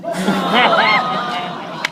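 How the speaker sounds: loud, high laughing disbelief; laughing hard while retelling. Real, not acted. Laughing retelling son's joke.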